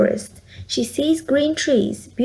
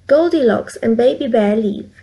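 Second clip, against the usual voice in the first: usual speaking voice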